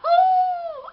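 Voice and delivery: high, loud frightened howl. Acted. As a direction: howl it long and scared. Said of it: "I howled".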